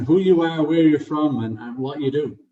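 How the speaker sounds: ordinary talk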